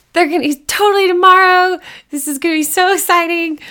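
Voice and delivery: high squeal of excited friends; friends gushing. Retold or imitated. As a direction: gush like thrilled girlfriends hyping her up. Friends texting back, thrilled.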